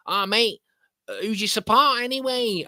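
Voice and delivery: high-pitched mock Mancunian; Mancunian lad. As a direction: put on a hammed-up Manc lad. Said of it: "he was saying".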